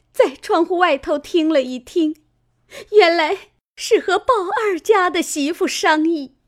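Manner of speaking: high, loud, weepy telling of the plot; a sobbing wife pleading her case; tearful complaint. A narrator's reading. Fengjie tells the matriarch of the plot, high and tearful. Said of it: "凤姐儿哭道".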